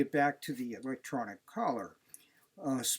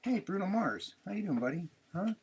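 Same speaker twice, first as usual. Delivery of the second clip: pet talk; 6 semitones higher than usual. Real, not acted. Greeting dog fondly.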